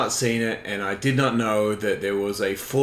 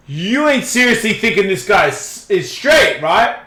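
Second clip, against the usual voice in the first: retold disbelief, pitched up; incredulous; 7 semitones higher, 3 dB louder than usual. Telling a man the porn actor isn't straight, incredulous. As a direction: challenge him as if it's obvious. Challenges the man about the video.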